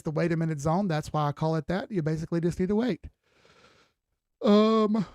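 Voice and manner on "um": yawning. Real, not acted. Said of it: "I'm sorry for the yawns".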